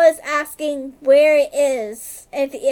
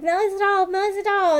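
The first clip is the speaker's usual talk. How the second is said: higher, panicked repeat of the name; frantic, calling her name over and over; freaking out; 6 semitones higher, faster than usual. Retold or imitated. Boyfriend panicking over his girlfriend, mildly retold frantic pleading. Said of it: "he's, like, freaking out".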